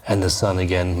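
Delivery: usual speaking voice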